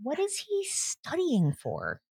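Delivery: slow high exasperated whine. Acted. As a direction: drag it out, fed up.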